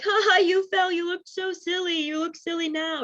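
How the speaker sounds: high, light giggly teasing; amused teasing. Retold or imitated. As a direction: chuckle at how silly they looked. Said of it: "Oftentimes it's like".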